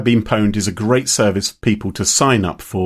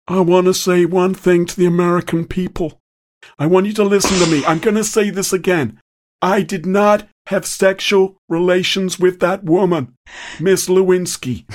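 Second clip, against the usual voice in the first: lightly voiced Clinton, a bit higher and deliberate; a hint of Clinton's denial speech; Bill Clinton impression; 6 semitones higher than usual. Host doing a politician for the quiz, lightly impersonated. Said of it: "I'm gonna do some impressions".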